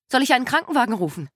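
urgent. Acted. Nurse urgently offers ambulance.

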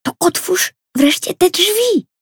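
put-on very high, slowed, whiny girl's voice; impatient little girl. A narrator's reading. Impatient sister urges brother to open the door, high whiny voice. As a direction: nag him to hurry up. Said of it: "zniecierpliwiła się Tosia".

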